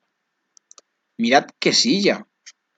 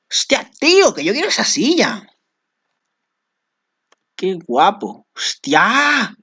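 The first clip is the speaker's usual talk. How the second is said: high, loud excited squeal of delight; 8 semitones higher, 3 dB louder, slower than usual. Real, not acted. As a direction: gush over the chair, way up high. Excited gamer sees a chair. (chillido emocionado)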